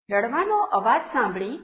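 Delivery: plain reading voice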